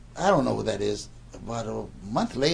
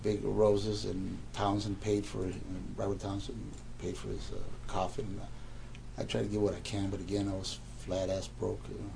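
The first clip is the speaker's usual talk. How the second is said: low and much softer; subdued recollection; 5 semitones lower, 10 dB quieter than usual. Real, not acted. Man recalls paying for late friend's funeral, subdued. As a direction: let the voice sink, quietly remembering.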